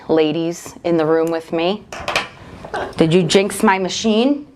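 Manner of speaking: normal talking voice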